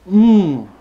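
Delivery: high, loud "mmm" of pleasure; savouring mmm. Real, not acted. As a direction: hum loudly over a tasty bite. Savouring food during a tasting.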